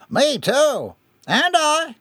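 high-pitched calls from the crowd; eager chiming in. A narrator's reading. Crowd members agreeing about the tobacco, eager calls. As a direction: pipe up in agreement.

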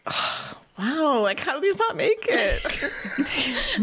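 high, drawn-out wonder breaking into a laugh; amazed disbelief. Retold or imitated. Fan first hearing the vault tracks, high amazed disbelief.

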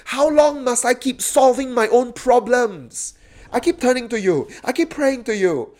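loud, high complaint to God; anguished cry. Acted. Psalmist's lament in modern language, anguished cry. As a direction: cry out as if worn out with pleading. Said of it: "Do you have that as your cry?".

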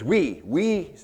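"We, we" lifted high, the word repeated for emphasis; stress on WE. Retold or imitated. Preacher shows congregation Paul's primary point, stressing "We, we". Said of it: "Paul's primary emphasis".

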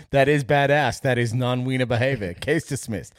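put-on loud high American-accented judge. Cohost plays a judge in a mock American accent. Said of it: "why he has an American accent".

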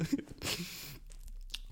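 quiet, breathy little laugh; soft chuckle. Real, not acted. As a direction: chuckle softly to yourself. Chuckles at his own rant.

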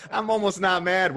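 amused disbelief, laughing. Real, not acted. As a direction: say it through real laughter. Repeats his line while laughing.

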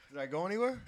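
normal talking voice

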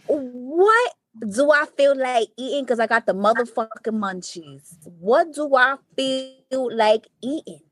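slow stoned musing. Retold or imitated. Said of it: "he's like".

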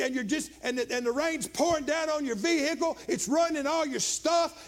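high-pitched, building pulpit storytelling; impassioned preaching. Acted. Preacher puts congregation in a gathering storm, impassioned preaching. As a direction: build relentlessly toward a shouted climax.

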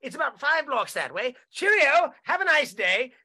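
put-on a light jokey English accent, chatty and helpful; mock English accent. Englishman kindly redirecting a lost stranger, mock English accent. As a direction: play a cheery English homeowner giving directions.

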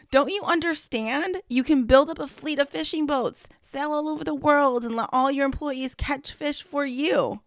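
angry businessman. Acted. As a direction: push it like he's losing patience. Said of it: "was getting angry".

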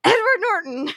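high, excited blurt of the answer; triumphant guess. Retold or imitated. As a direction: shout it like you finally got it. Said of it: "and then it's like".

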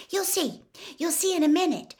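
high-pitched eager excitement; excited. A narrator's reading. Hermione leading Harry downstairs, high and excited. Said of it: "said Hermione excitedly".

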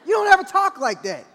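loud, high-pitched protest; exasperated scolding. Retold or imitated. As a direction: raise your voice at the kids in disbelief. Retold father snapping at children.

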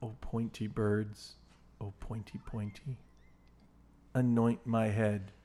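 slow, low, soft recitation; hushed quote reading. Acted. Host reading a movie poem for a quiz, hushed slow recital. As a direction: recite it low and slowly, with pauses.

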